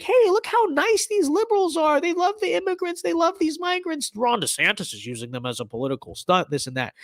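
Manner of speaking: high sarcastic mimicry of smug liberals; mocking voice. Vlogger mocks the liberals' argument, high sarcastic voice.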